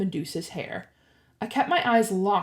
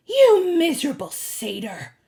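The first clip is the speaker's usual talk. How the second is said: loud, raised, enraged voice; roaring with rage; angry snarl; 8 semitones higher, 5 dB louder than usual. A narrator's reading. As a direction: snarl it as an insult. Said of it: "she snarled".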